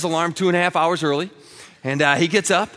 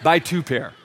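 soft, hushed, sweet-talking husband; smooth it over lovingly, tongue in cheek; mock sweet reassurance; 7 semitones lower, 4 dB quieter than usual. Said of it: "look at you and go".

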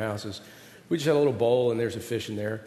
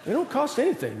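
laughing; 9 semitones higher, 5 dB quieter than usual. Acted. Consoling son, laughing.

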